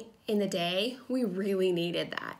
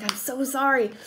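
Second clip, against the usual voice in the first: higher-pitched retold apology; embarrassed apology; 5 semitones higher, 4 dB louder than usual. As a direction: re-enact an embarrassed sorry. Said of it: "I was embarrassed".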